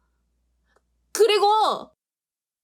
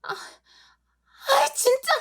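exasperated groan; 9 semitones higher, much slower than usual. Acted. Exasperated at his stiffness.

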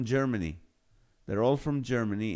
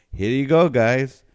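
loud teasing call; 3 semitones higher, 14 dB louder than usual. Real, not acted. Teasing co-host about food talk.

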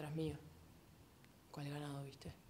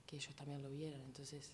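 ordinary delivery

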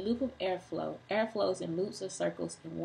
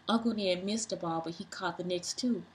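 plain reading voice

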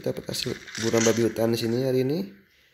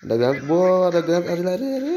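higher and louder, rising to a panicked yell; grenade warning yell; 7 semitones higher, 5 dB louder, faster than usual. Real, not acted. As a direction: shout the warning, then urge them to run. Grenade incoming, warning teammates.

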